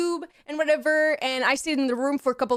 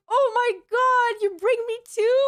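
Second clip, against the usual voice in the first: drugged-out amazement; 8 semitones higher, 4 dB louder than usual. Retold or imitated. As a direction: gush in woozy wonder. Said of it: "I was gone".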